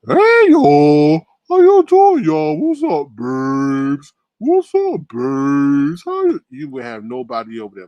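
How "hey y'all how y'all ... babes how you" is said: high, loud mocking Bertha greeting. Mocking a viewer's voice as Bertha, high and loud. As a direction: ham up a loud high caller greeting the chat. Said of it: "You sound like, I don't know, Bertha".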